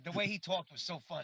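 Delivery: ordinary talk, nothing marked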